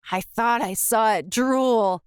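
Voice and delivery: ordinary delivery, nothing marked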